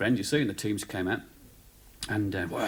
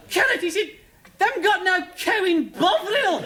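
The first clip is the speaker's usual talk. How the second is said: loud high indignant shout; shouting complaint; 6 dB louder than usual. Retold or imitated. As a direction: complain loudly to the whole room.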